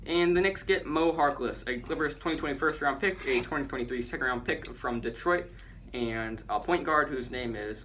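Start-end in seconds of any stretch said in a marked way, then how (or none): none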